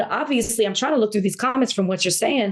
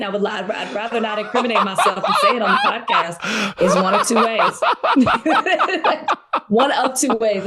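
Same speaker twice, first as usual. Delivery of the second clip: words spilling through real laughter; laughing. Real, not acted. Laughing while answering about divorce.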